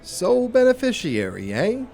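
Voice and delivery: high, loud, questioning echo; pointed echo. Acted. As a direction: pounce on it as a suspicious question. Detective reacts to the policy story.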